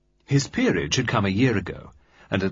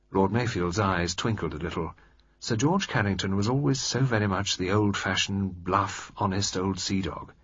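normal talking voice

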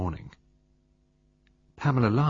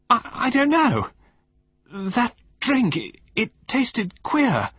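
faint, frightened gasp; 5 dB louder, slower than usual. A narrator's reading. Poisoned wife struggling to speak.

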